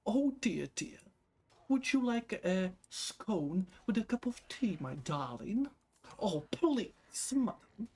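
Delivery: high, prissy posh British English; mock the posh British English, all airs; hammed-up posh British English. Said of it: "beautiful, posh British English".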